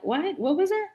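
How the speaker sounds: high-pitched baffled question; puzzled reaction. Retold or imitated. Soldier not understanding the Louisiana friend's word, baffled. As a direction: ask it high, totally lost. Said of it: "and I'm like".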